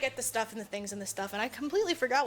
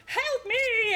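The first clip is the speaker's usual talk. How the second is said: high, squeaky mock panic; mock cry for help; 12 semitones higher, 4 dB louder than usual. Podcaster voices the helper being crushed by the unicorn, high mock panic. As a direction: yelp it like a cartoon victim.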